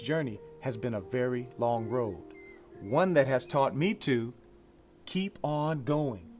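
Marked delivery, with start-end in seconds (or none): none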